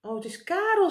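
high, pleased exclamation; delighted to see who it is; glad. A narrator's reading. Meneer Pen spots Karel overhead, glad cry. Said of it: "zei meneer Pen blij".

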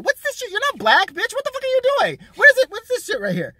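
high, loud outraged yelling. Real, not acted. Ranting at the girl on TV, high and shrill. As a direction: blow up at her in disbelief.

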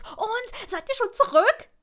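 high, loud, eager burst; excited babbling. A narrator's reading. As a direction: pounce on her with the question. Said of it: "brabbelte ihre Freundin wild drauf los".

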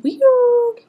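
high, slow squealed exclaim; squeaky squeal. Real, not acted. Squealing about yellow eyes.

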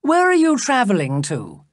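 high, eager question; lively cry. A narrator's reading. The king questions his new-found cousin, lively cry. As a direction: ask it brightly pitched, falling from a high start. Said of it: "he cried".

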